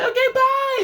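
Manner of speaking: very high, loud squealed goodbye; drunken party girls. Retold or imitated. Drunken bachelorettes running off, high loud goodbye. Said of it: "even more drunkenly".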